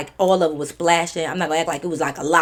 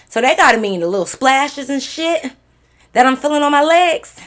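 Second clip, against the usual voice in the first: loud, high, incredulous outburst; retold realisation, pitched up and loud; indignant realisation; 7 semitones higher, 7 dB louder than usual. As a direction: raise your voice in outraged disbelief. Disgusted realisation in story.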